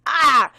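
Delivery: sudden loud yelp of panic; mock freak-out scream; panicked yell. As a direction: blurt out a short scared yell. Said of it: "and I'm just like".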